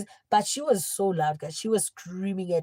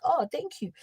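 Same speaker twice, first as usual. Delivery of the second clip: high-pitched, airy 'oh' of thanks; mock-polite retold thanks; unbothered thanks; 8 semitones higher than usual. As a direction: brush it off with a sweet thank-you. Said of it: "I'd be like".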